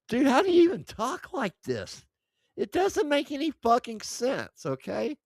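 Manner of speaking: high-pitched, baffled exasperation; exasperated. Real, not acted. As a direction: protest in disbelief, pitch way up. Baffled rant at pronoun examples.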